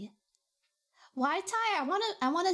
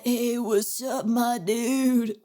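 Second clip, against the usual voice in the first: chill guy voice; 5 dB louder, much slower than usual. Pretend. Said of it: "that's what he sounds like".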